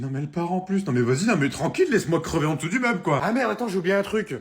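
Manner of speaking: usual speaking voice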